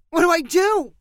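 put-on high, frantic boy's cry; panicked cry. A narrator's reading. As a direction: cry out in panic as a scared kid. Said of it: "Jagger cried out".

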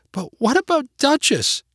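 put-on high, upset little-girl protest; child's cry. A narrator's reading. Girl worried about the dog, high upset cry. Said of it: "cried Mary".

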